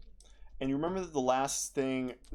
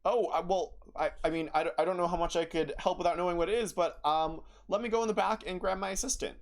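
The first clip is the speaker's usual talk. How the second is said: flustered; 6 semitones higher, faster than usual. Acted. Flustered shopkeeper stammers.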